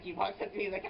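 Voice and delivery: ordinary talk, nothing marked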